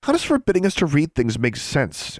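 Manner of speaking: high-pitched scoffing question. A narrator's reading. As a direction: scoff at the rule, pitch lifted. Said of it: "Alexandra scoffed".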